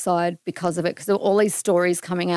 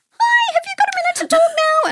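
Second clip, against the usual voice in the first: mimicking the PR agent in a much higher, fast, tense voice; agent's high tone; 5 dB louder, faster than usual. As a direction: squeak it out fast like a nervous agent. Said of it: "That is a very high tone".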